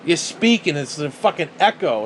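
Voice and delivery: ordinary delivery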